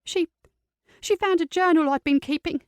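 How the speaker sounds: put-on young niece, hurt and tense; teenage girl voice. Teen niece Sophie voiced.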